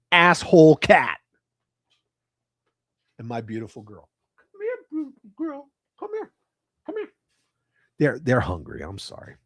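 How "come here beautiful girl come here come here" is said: high soft coaxing call; calling the cat. Real, not acted. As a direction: coax the cat over gently.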